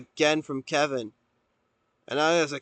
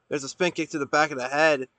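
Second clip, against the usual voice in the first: ordinary delivery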